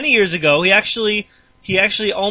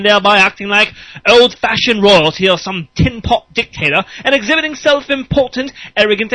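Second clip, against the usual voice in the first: higher, louder posh British solicitor; British accent; 5 semitones higher, 5 dB louder than usual. Acted. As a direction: read it like a pompous British lawyer. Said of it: "just pretend I'm them".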